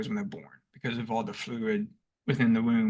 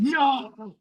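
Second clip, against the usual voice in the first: sudden high shout of no; panicked yell; 13 semitones higher than usual. Retold or imitated. Friend on the phone hears a panicked yell of no. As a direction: cry out as the truck spins. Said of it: "I said".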